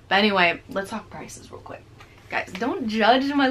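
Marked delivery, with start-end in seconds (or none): 0.7-1.8 soft whispered transition; hushed quick aside. Real, not acted. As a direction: drop to a breathy murmur to move on. Quiet aside moving on.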